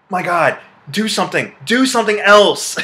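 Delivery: rising, exasperated outburst; exasperated plea. Real, not acted. Reviewer ranting at gum-chewing actor, exasperated.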